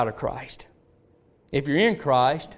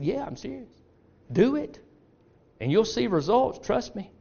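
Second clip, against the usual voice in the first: a lightly voiced father, higher, hushed and conspiratorial; a sly old father giving secret advice, pitched up a little; scheming father; 8 semitones higher, 4 dB quieter than usual. Father voice continues.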